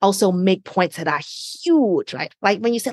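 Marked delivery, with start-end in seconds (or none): none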